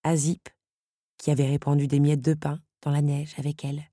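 usual speaking voice

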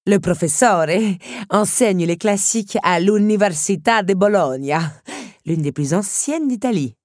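grand countess making introductions; countess introducing. A narrator's reading. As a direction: present the guest with ceremony. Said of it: "précisa la comtesse".

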